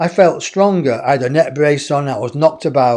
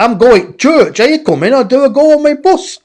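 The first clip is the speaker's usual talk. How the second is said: put-on Yorkshire accent; 9 semitones higher, 5 dB louder than usual. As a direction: answer back in hammed-up broad Yorkshire. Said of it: "In a Yorkshire accent".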